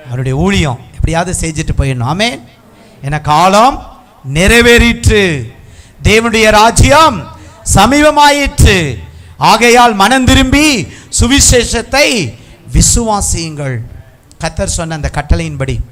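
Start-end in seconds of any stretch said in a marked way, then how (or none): 3.0-13.8 slow, high, drawn-out preaching cry; scripture proclamation. Real, not acted. Preacher calling listeners to repent, slow ringing proclamation. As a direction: herald each phrase, pausing between.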